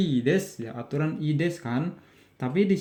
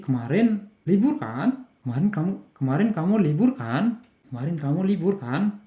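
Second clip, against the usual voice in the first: higher-pitched confirming question, "kan" rising; nada naik; 5 semitones higher, 3 dB louder than usual. Shown as a demonstration. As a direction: lift "kan" as if checking a guess. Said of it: "intonasinya naik". ("kan" diucapkan dengan nada naik)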